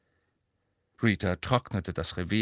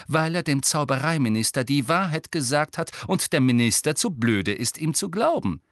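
louder, firm and clear; hard, clear voice; 6 semitones higher, 4 dB louder than usual. A narrator's reading. Hermine defending Harry to the reporter, hard and clear. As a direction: state it hard and plain. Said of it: "mit harter, klarer Stimme".

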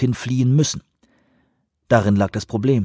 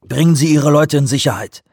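commanding, higher and louder; giving an order; 6 semitones higher, 6 dB louder than usual. A narrator's reading. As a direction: order him out firmly. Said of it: "hatte er dem Mann befohlen".

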